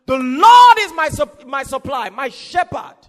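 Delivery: loud, slowed shout; shouted proclamation. Acted. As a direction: declare it at full voice. Preacher proclaiming the psalm.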